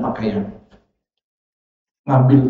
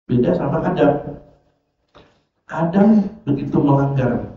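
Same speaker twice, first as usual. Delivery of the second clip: usual speaking voice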